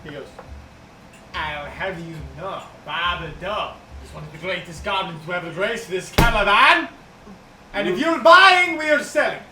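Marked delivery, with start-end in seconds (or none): 1.3-9.4 proud goblin merchant. Acted. Goblin defends Babado.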